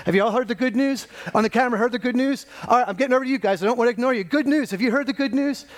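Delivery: high, eager calling round the room; Kevin. Acted. Preacher trying not to ignore anyone, urgent calling. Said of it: "imagine if Kevin was just going out there to preach".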